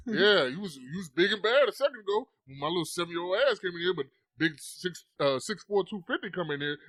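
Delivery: loud, cocky mock taunting; hype man. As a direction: taunt like a hype man backing up the big guy. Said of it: "felt like a hype man".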